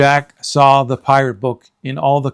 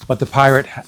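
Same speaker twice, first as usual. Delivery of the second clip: plain reading voice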